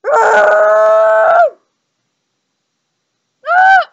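loud high scream. Acted. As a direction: let out a big scream. Scream at photo effect.

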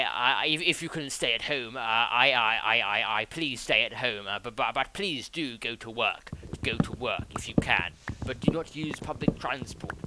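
put-on lightly done posh English Tory accent with bumbling stammers; a hint of a blustering prime minister, stuttering uh and ba; Bojo accent. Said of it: "a good, uh, b-, b-, b-, Bojo, uh, accent".

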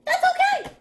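high, bright, loud reassurance; reassuring. Acted. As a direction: comfort the little ones cheerfully. Reassuring scared young listeners.

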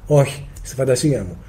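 a soft, sheepish confession; sheepish admission. Acted. Answering the teacher's question, softly and sheepishly. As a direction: own up quietly, a little embarrassed.

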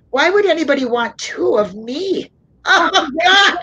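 real happy tears breaking through the words; crying from joy. Real, not acted. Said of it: "crying every time I get excited".

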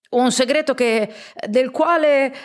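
uncle stumbles over his words, a touch flustered; lightly voiced falter; stammering. Caught out uncle stammers to his host, flustered. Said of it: "balbettò lo zio".

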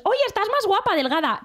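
high, mock-sweet aunt praising weight loss; mock nosy aunt. Aunt voiced commenting cousin's weight. (tía cotilla imitada, aguda y falsamente dulce)

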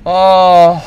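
sudden loud "ah" yelp; startled shout. Real, not acted. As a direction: yelp in alarm. Sudden loud cry in game.

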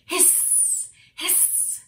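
two short hisses; snake hiss. A narrator's reading. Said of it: "hiss again like a snake".

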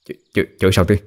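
stuttering, hesitant question; nervous stammer. Acted. Son asking how dead grandparents scold dad, nervous stammer. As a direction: trip over the first word before asking.

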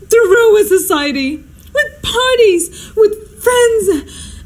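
high-pitched, drawn-out lament; melodramatic renunciation. Acted. Hostess swearing off society after a fiasco, melodramatic renunciation. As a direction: renounce each one with theatrical disgust.

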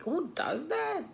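can't believe what she's hearing; outraged disbelief. Real, not acted.

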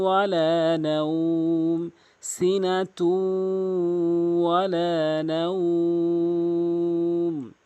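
slow melodic recitation; tajweed recitation; Quran recitation. Acted. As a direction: chant it slowly and evenly. Recites Ayat al-Kursi.

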